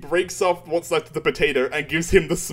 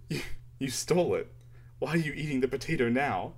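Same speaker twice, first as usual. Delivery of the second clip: quiet baffled instructor; 8 dB quieter, faster than usual. Retold or imitated.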